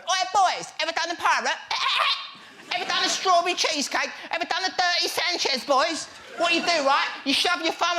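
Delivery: hammed-up laddish banter; laddish dressing room boast. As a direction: brag to the boys like a football wanker. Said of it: "one wanker going".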